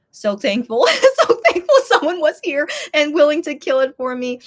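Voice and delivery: high, loud thankfulness with laughter; laughing relief. Real, not acted. Telling how someone killed the spider, laughing and thankful.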